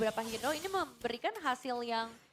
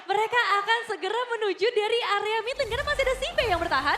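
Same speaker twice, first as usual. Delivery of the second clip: excited; 7 semitones higher, 8 dB louder than usual. Real, not acted. Caster reacts to triple kill.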